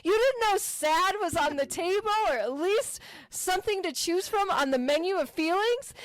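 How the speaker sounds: high, disbelieving outburst; incredulous. Retold or imitated. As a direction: act floored by the answer. Retelling her incredulous reaction.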